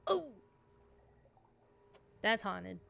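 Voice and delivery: normal talking voice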